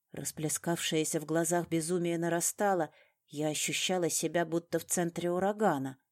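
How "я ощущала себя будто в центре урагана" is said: ordinary delivery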